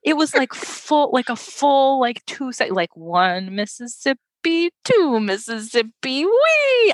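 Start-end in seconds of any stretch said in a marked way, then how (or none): 3.0-6.2 slow counting. Shown as a demonstration. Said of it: "counting out the delay". 6.2-6.9 high, loud playful squeal; squealed wee. Retold or imitated. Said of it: "imitating the launch".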